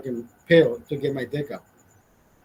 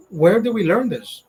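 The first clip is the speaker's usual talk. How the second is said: normal talking voice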